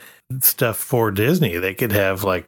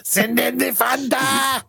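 high, shouted mock German officer; yelling German voice; 13 semitones higher, slower than usual. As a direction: bark it out like a cartoon German officer. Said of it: "instead of the always yelling Nazi voice".

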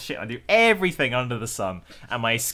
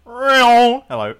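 higher and louder, as if whooshing past; passing by doppler; 11 semitones higher, 10 dB louder than usual. Pretend. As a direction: say hello while zooming past the mic. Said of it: "that was the Doppler effect".

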